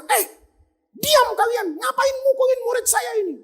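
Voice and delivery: high-pitched scolding yell; acted angry shout. Tabrizi scolding the crowd beating his disciple, high shout. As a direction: yell at a mob to back off. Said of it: "Dia teriak".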